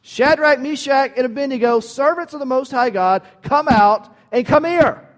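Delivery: loud, high royal summons; commanding royal shout; king's loud call. Acted. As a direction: call them out of the fire like a king. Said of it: "and he declared".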